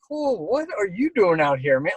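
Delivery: high, bright friendly hello; excited greeting. Retold or imitated. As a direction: greet a fellow chaser cheerfully. Retold greeting between old chasers.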